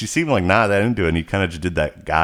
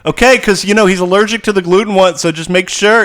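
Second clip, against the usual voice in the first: loud mock-sweet nagging, pitched way up; submissive partner; 11 semitones higher, 7 dB louder than usual. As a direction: play a fussy, submissive husband in falsetto. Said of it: "you want this submissive".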